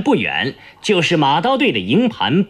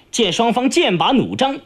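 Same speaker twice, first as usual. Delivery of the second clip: usual speaking voice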